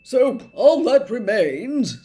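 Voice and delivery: the Minister speaking grandly; Fudge voice. A narrator's reading. Minister to Harry, jovially loud.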